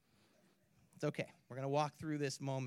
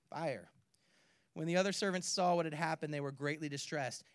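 ordinary talk, nothing marked

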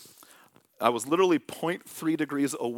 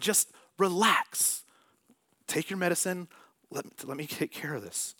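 reassuring God's voice; 6 semitones higher than usual. Pretend. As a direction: ease him into calm.

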